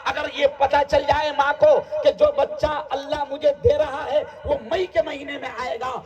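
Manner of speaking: ringing exhortation; impassioned preaching. Real, not acted. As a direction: preach it out forcefully to the crowd. Preaching at full force.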